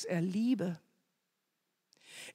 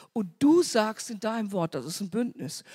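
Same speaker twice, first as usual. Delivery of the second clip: ordinary delivery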